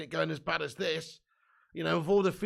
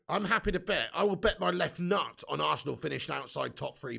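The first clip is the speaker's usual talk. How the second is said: ordinary delivery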